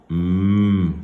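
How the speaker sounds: sustained hummed "M"; isolated sound. Shown as a demonstration. Teacher giving pupils the last sound, hummed "M". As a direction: hum "M" alone, without a vowel. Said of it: "c'est le son".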